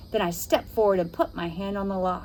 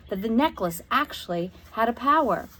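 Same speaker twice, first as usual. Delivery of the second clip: ordinary delivery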